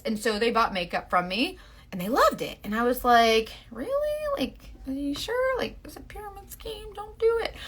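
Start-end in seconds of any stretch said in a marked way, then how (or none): 3.7-7.5 high, small, doubtful mock plea; retold disbelief. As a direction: squeak out a quiet, incredulous warning. Retells her disbelief at friends buying.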